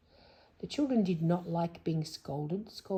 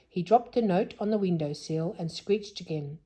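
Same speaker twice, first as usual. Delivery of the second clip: ordinary delivery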